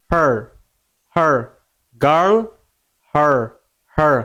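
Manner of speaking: হার and গার্ল with the same held R sound. Shown as a demonstration. Teacher matching two words for learners, held R in হার and গার্ল. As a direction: hold the R in each হার and গার্ল. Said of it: "ধরে রাখতে হবে একটু".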